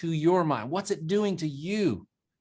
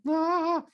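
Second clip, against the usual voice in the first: squeaky yelp; 11 semitones higher than usual. Retold or imitated. Voice cracking like Pinocchio.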